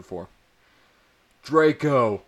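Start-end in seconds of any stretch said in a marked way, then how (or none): 1.4-2.2 loud, higher shout of his name. A narrator's reading. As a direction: call him out sharply. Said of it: "I shouted".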